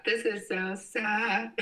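lifted high and slowed, whiny; exaggerated high mopey whine; mock sad high voice. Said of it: "repeats it in a put-on voice".

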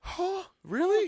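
high surprised huh. Real, not acted. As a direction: sound genuinely taken aback.

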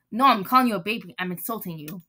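ordinary talk

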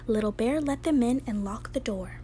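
usual speaking voice

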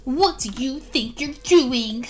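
ordinary delivery

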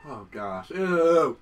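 ordinary delivery

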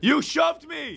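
high-pitched indignant protest; accusing cry. Real, not acted. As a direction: squeal the blame at your teammate. Accuses teammate.

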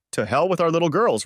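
angry, hurt husband; bitter accusation. A narrator's reading. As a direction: throw the words at her.

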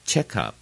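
strong check, lighter up in check up; front stress. Shown as a demonstration. Teacher modelling the phrase, stress on check. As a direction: check up with weight on check. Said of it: "这两个字重音在前面".

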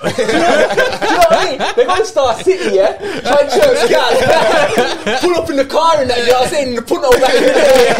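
high, loud and laughing; excited outburst. Real, not acted. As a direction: shout it out through laughter, really worked up. Excited banter about nightlife back home.

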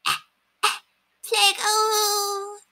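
play-acted dying coughs, then a high moan; pathetic cough.

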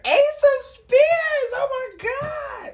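high, loud, slow shriek of delight; screaming with sudden joy at the card; excited shout. Real, not acted. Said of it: "I just got excited".

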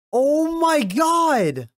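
high, slow, loud cry of shock; shocked wail. Real, not acted. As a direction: let it drag out in disbelief. Shocked reaction.

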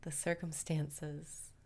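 calm guru voice leading the breathing; guided meditation. Acted.

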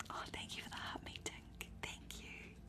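usual speaking voice

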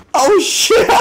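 very high, loud laughing yell; thrilled high whoop with a laugh; laughing shout. Real, not acted. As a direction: shriek it and break into laughter. Stunt goes off in game.

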